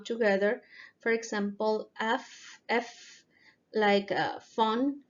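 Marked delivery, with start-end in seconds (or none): 2.0-3.2 F as an isolated soft sound; labiodental F. Shown as a demonstration. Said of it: "labiodental sound".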